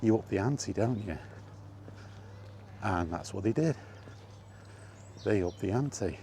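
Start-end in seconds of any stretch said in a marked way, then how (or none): none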